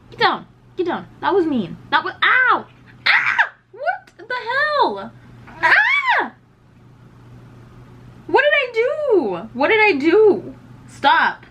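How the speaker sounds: high, loud scolding of a pet; shrill outraged complaints at the animal; upset scolding. Real, not acted. Scolding the pet, being hurt.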